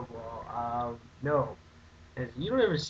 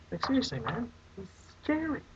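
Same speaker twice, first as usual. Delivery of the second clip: mock scared; 9 semitones higher than usual. Acting scared of Jeepers Creepers.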